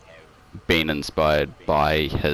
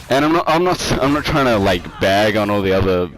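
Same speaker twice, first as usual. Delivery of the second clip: emphatic; 5 semitones higher, 6 dB louder than usual. Real, not acted. Defending his view emphatically.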